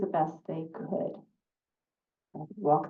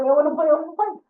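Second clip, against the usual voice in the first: excited dog; 7 semitones higher, 7 dB louder, much faster than usual. Acted. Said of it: "Because Dog says".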